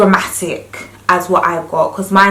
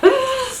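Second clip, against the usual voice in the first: sharp gasp. Retold or imitated. Wind hitting sensitive teeth.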